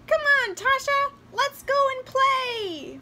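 high, loud little doll voice; doll calling out. A narrator's reading. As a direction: call out eagerly as a doll come to life. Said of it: "then the doll called".

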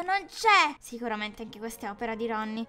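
usual speaking voice

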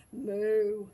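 loud, long moo; cow's moo. A narrator's reading. Cow telling the duck the story, loud moo. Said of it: "said the cow".

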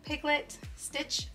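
ordinary delivery

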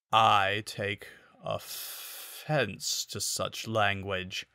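offense overemphasized on off, ents slurred, pause before language; drunken slur. A narrator's reading. Panther slurring drunkenly at Miyu, slow and soft. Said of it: "butchered the word offense".

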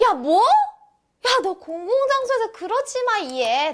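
high-pitched mock-appalled telling-off; shocked scolding. Friend reacting to a fart in public, mock-appalled scolding. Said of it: "이때 할 수 있는 반응".

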